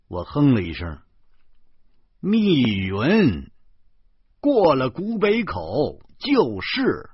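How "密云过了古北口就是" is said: "密云" high, loud and drawn out; emphatic pronouncement. A narrator's reading. Passenger gives the driver the answer, "密云" stretched emphatically. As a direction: proclaim "密云" slowly, then punch "就是".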